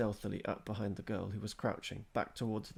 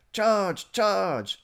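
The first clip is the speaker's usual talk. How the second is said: much higher and louder, a full-voiced yell; battle yell; 11 semitones higher, 13 dB louder than usual. A narrator's reading. Boy on a big man's shoulders charging the others, yelling at full voice. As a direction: bellow it like a knight charging. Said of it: "Eric yelled at the top of his voice".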